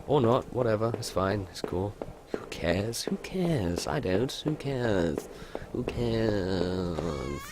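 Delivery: soft, fading singsong shrug; hushed mock indifference; dismissive. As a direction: let it trail away quietly, line by line. Mock shrugging off, trailing away.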